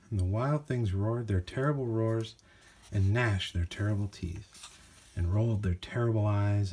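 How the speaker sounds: plain reading voice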